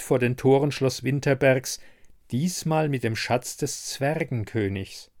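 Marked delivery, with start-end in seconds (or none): none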